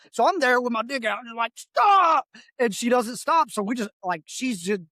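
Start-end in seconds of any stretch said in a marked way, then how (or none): 1.6-2.3 sudden loud, high-pitched shout; shouted command. Retold or imitated. Soldier ordering an approaching driver to halt, shouted command. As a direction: yell it like a warning to halt.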